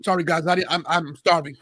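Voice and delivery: talking through a mouthful; chewing between words; wolfing down food. Real, not acted. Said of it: "so I gotta eat".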